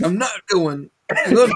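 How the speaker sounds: high-pitched, laughing mock protest; mock stubborn refusal. Host teasing friend about staying on the plane, mock stubborn. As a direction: dig your heels in, jokingly, through laughter. Jokingly voices a passenger refusing to leave.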